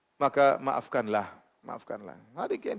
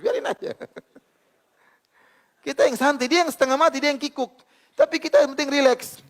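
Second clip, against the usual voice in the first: high, loud, driving sermon voice; fervent preaching; 6 semitones higher, 5 dB louder than usual. Acted. Ustadz urging husbands and wives to forgive, in full-force preaching. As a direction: preach it out to the congregation.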